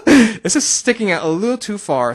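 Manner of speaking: loud, high giggle running into speech; laughing at one's own excitement, high and loud; tickled laughing. Real, not acted. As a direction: talk through real laughter. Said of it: "I tickled myself just a little bit".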